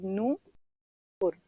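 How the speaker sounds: Nupur stretched very slowly; slow dictation of Nupur; drawn-out word. Shown as a demonstration. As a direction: draw out Nupur as if writing it. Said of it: "Nupur इस तरह लिखा जाता है".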